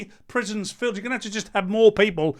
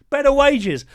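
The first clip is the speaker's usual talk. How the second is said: louder, higher mocking repeat; scornful echo; 7 semitones higher, 5 dB louder than usual. Shown as a demonstration. Echoing the Greens' slogan back at them in scorn. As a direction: throw their phrase back with scorn. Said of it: "How are you going to pay".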